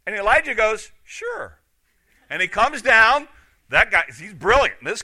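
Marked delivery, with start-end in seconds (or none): 1.0-1.6 high-pitched, soft "sure"; mock easy agreement. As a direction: toss out a soft, squeaky-high yes. Elijah casually agrees to plea.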